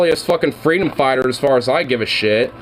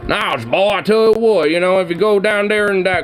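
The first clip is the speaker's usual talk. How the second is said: higher, hammed-up southern twang; 4 semitones higher than usual. Streamer mocking southern QAnon types, exaggerated southern twang. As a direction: play a preachy southern good old boy in a southern twang. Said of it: "more like a southern twanged accent".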